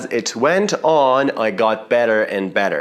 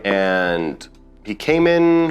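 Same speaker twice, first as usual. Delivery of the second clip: ordinary talk, nothing marked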